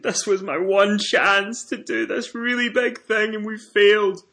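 high, slow, hammed-up wail of defeat; mock despair. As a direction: whine it like all is lost, half-joking. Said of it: "cradling myself".

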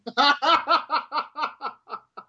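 high, rapid laugh tailing away; helpless laughter. Real, not acted. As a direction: burst out in a high cackle. Cracking up at the joke.

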